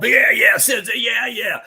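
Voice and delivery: loud, high eager yelling of students; a burst of loud, high fan-boy enthusiasm; eager students shouting. Retold or imitated. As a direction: cheer it out like keen students who can't wait. Students eager about stick fighting.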